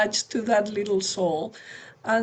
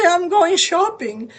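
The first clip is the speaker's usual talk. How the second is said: higher and louder, a playful huff; defiant declaration; 7 semitones higher, 8 dB louder than usual. Retold or imitated. Exhausted mother handing off the baby to her husband, playfully defiant. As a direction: announce it like a fed-up mom escaping. Tired mom announcing to husband.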